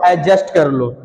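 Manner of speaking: low-pitched एडजस्ट with silent D; correct pronunciation. Shown as a demonstration. Teacher models the right form for students, correct pronunciation. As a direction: glide straight into "j" in एडजस्ट, no "d". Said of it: "क्या कर लो".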